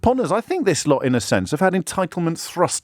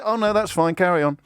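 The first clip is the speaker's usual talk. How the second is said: instantly placated; 3 semitones higher than usual. Acted. Supporter excuses attacks on judges for Brexit, instantly placated. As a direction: protest collapsing into casual assent.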